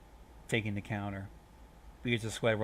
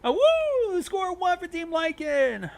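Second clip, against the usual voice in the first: very high, loud wolf howl into a cheer; 10 dB louder, slower than usual. A narrator's reading. Lycans cheering for their team, howling high and loud. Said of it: "throwing their heads back and howling".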